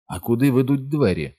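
high-pitched called-out question. A narrator's reading. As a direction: call the question across to someone. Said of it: "гукнув Ричард".

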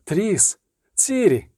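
high, softened cries of names; gull calls. A narrator's reading. Gulls calling to Triss in her vision, high calls. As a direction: call the names like distant gulls. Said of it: "Чайки дико кричали".

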